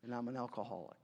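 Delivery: low, soft, halting introduction; lightly voiced, quiet admission with long pauses; subdued AA member. AA member introducing himself to the meeting, subdued and low. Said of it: "you run into people like this".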